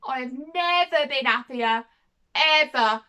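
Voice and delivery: heartfelt, loud emphasis on each word; emphatically delighted. Real, not acted. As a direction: stretch it out and punch the last word. Recalling joy at coming off medication.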